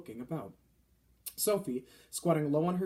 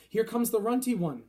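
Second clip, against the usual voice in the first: big, loud, higher taunting call; booming; 7 semitones higher, 6 dB louder than usual. A narrator's reading. Fleshlumpeater taunting the BFG from afar, booming. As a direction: boom it across the plain at the small giant. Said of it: "boomed the flesh lump eater".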